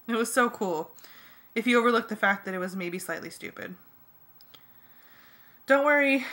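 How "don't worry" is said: loud, high reassuring call. A narrator's reading. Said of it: "I called to Jake".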